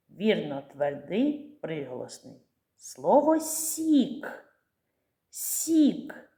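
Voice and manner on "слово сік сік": very slow, higher, drawn-out word; slow word demo. Shown as a demonstration. Teacher sounds out a word for children, very slowly. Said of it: "Який перший звук у слові сік?".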